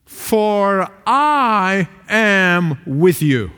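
very slow, every word weighted; "For I am with you" drawn out word by word; slow, weighty reading. Shown as a demonstration. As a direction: let each word land. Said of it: "you got to read".

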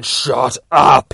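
very loud, drawn-out shout; scream. A narrator's reading. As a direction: scream both words hard. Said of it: "he starts screaming".

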